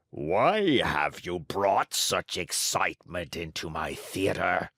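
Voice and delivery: low, slow, thick ogre voice; a menacing ogre with a cold. Acted. The director questions Pinocchio, a low slow ogre voice with a cold. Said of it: "with the voice of an ogre suffering with a cold".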